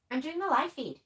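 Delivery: normal talking voice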